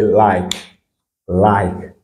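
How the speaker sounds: "like" with i said as eye; English I sound. Shown as a demonstration. Teacher repeats an example for learners, "like" with eye vowel. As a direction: model "like" as a separate word, vowel gliding like I. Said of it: "on a dit, c'est I".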